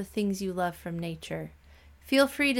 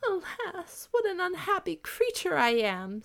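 much higher, wailing lament; mock sobbing princess in the rain; 11 semitones higher than usual. A narrator's reading. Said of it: "sobbed the princess".

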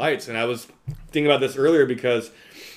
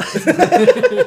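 high laugh; 9 dB louder than usual. Real, not acted. Laughing at the quote.

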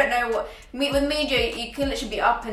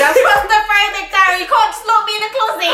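put-on whiny little kid; 11 semitones higher, 10 dB louder than usual. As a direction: wail it like a bratty toddler. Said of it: "your little kid".

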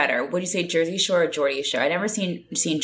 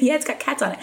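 usual speaking voice

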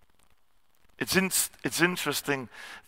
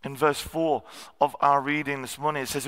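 ordinary talk

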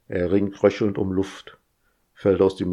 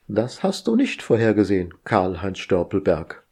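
plain reading voice

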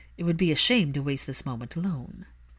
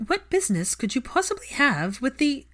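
higher, clipped-off demanding question; Narcissa's voice; 8 semitones higher, 3 dB louder than usual. A narrator's reading. Narcissa presses Draco about business, higher and demanding. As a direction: press him as his mother would.

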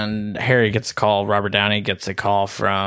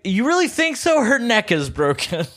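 high mocking sarcasm, pitched way up; sarcastic Val Kilmer; 11 semitones higher than usual. Val Kilmer mocking Harry's obvious remark, high sarcastic sneer. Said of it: "And Val Kilmer's like".

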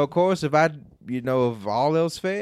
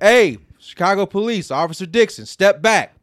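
commanding shout, raised pitch; loud police voice; 7 semitones higher, 10 dB louder than usual. Shown as a demonstration. Officer demonstrating how to order people back, loud command. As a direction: announce yourself at full volume. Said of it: "You just gotta be loud as fuck".

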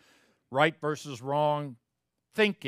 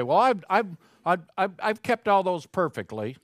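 higher, stammering self-defense; lightly mocked higher-pitched protest; acted self-justifier; 6 semitones higher, 3 dB louder than usual. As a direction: trip over a hasty boast of innocence. Voices someone claiming perfection.

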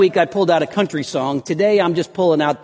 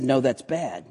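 softer, falling comic correction; downbeat contradiction; 3 semitones lower, 6 dB quieter than usual. Acted. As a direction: counter glumly, letting "bad" drop. Comic song's good-bad back-and-forth.

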